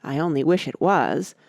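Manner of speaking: plain reading voice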